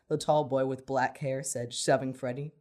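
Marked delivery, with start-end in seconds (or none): none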